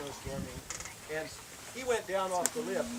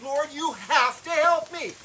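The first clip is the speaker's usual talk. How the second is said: praying for a friend on the cliff, frantic; frantic prayer; 7 semitones higher, 6 dB louder than usual. Retold or imitated. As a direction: beg the Lord urgently. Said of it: "Now I'm praying frantically".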